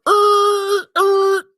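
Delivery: high, loud grunting yell; football grunt shout. Pretend. Host mimes a Herschel Walker stiff arm, loud grunt. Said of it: "hit you with that Herschel Walker".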